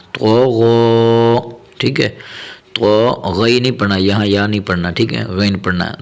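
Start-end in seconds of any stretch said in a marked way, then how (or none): 0.1-1.4 تو غو slow with thick "to" and "gho"; heavy, full letters. Shown as a demonstration. Teacher showing the thick ghain, heavy drawn-out تو غو. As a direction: make both letters heavy in تو غو. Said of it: "اسے بھی موٹا کر کے پڑھنا ہے".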